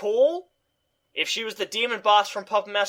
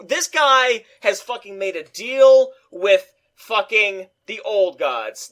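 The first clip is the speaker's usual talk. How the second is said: ordinary delivery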